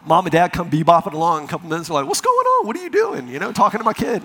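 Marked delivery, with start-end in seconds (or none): 2.1-3.3 high-pitched mock parent voice; oblivious parent. Parents bebopping along to their kid, high mock-clueless questioning.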